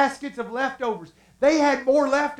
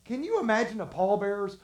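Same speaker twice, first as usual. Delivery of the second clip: ordinary talk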